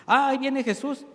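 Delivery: high, loud mock challenge, "ahí viene Jesús"; Marta's confrontational attitude. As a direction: voice Marta bristling as Jesus comes. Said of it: "Fíjese la actitud". (Marta desafiante, aguda y fuerte)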